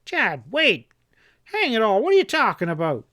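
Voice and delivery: high, fast panicked plea; terrified cry. Acted. Henchman crying out in terror at his armed boss. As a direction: cry out for your life. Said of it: "Epp cried out in a terrified voice".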